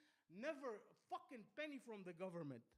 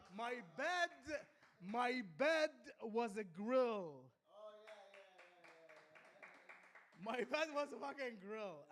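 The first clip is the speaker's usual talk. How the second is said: loud complaint; 9 dB louder, slower than usual. Real, not acted.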